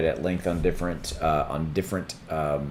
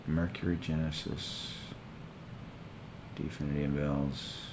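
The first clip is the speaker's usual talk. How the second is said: quiet reading aloud; 6 semitones lower, 15 dB quieter than usual. Real, not acted. Reading search results quietly.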